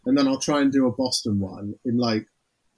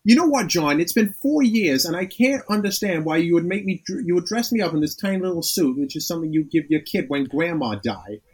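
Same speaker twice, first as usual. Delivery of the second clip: put-on Boston accent. Ted's line in a light Boston accent. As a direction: grumble it in a mild Boston accent. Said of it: "Boston accent".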